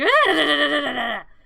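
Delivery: loud stuttering imitated wail; creature wail. Pet cries out while being bandaged, wailing loudly. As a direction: wail in bursts like a hurt critter.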